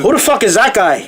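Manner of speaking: put-on a lighter stage Irish accent. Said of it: "interview you as Conor McGregor".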